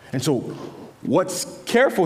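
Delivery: normal talking voice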